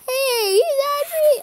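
slow, very high squealed exclamation; high-pitched cry. Acted. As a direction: squeal it out, stretching the words. Angry creature cry in story.

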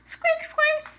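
very high squeaky bunny; one piercing little bunny squeak; chocolate bunny squeak. Pretend. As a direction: squeak like a toy rabbit. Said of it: "jumping around and saying squeak".

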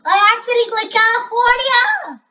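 high, loud frustrated scream; real loud scream at the game. Real, not acted. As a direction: scream out the mistake. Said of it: "I'm screaming way too loud".

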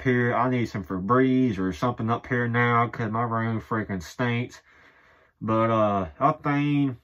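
muffled through fabric; through shirt held over mouth. Real, not acted. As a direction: hand covering mouth. Seen 2: Shirt pulled over nose and mouth, held by hand throughout.

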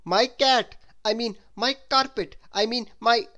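loud, high, flustered corrections; exclaiming. A narrator's reading. Mister Topsy-Turvy muddles his words at the house, exclaiming. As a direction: blurt it out loudly, correcting yourself. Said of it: "exclaimed Mister Topsy-Turvy".

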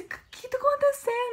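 high-pitched, anguished mock question; imitated tearful mother. Mother asking her crying daughter what is wrong, imitated tearful alarm. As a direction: wail the question as an upset mother. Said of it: "Minha mãe começou a chorar junto".

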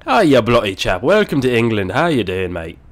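put-on muddled British and Australian accent; hammed-up British-Australian chap. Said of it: "that was a mix of Australia".

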